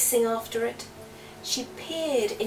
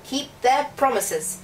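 stern king; 4 semitones higher, 6 dB louder than usual. Acted. King repeating the rule.

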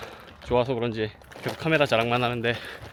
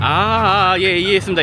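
loud; 5 semitones higher, 9 dB louder than usual. Real, not acted. Loud over road noise to client.

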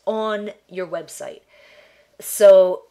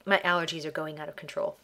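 ordinary talk

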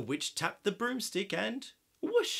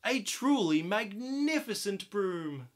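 ordinary delivery, nothing marked

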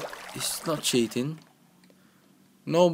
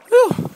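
high, loud whoop of relief; high whew; 14 dB louder than usual. Real, not acted. Gamer nearing the end of the course, loud high whew. Relief before final stretch.

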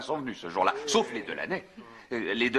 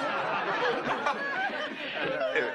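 laughing. Real, not acted. Laughs after telling the yell.

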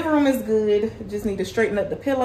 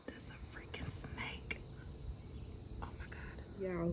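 scared whisper; 3 semitones lower, 22 dB quieter than usual. Real, not acted. Spotting a snake by the house.

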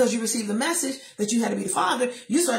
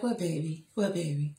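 soft, low, honeyed mother's voice; sweet mama voice; 5 semitones lower, 6 dB quieter than usual. Retold or imitated. Mother answering her son in a sugary low coo. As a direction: coo at him like a doting mom. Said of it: "I'm giving him this voice".

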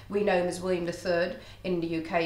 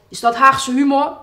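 louder, higher quip in Haags Dutch; Hague dialect; 5 semitones higher, 11 dB louder than usual. Acted. Falling king quipping in Haags, loud and higher. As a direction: toss off the joke in Hague dialect. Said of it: "I'm doing this in Haags".

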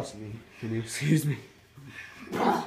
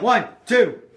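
loud pin count, higher; referee count; 8 semitones higher, 10 dB louder than usual. Pretend.

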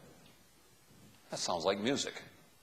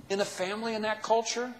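ordinary delivery

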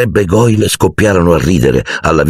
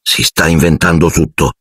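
plain reading voice

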